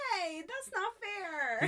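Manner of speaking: high, slow whine; mock whiny protest. Eun-chan left in the water, a high slow whine. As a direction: whine at him, playfully. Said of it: "And she's like".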